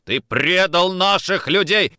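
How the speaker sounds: slow, loud, high accusation; angry accusation. Acted. Nikitin cocking pistol at Orlov, angry accusation. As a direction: hammer out the charge in anger. Officer accusing suspected traitor.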